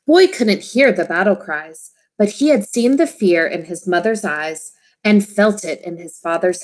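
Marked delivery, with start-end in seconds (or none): none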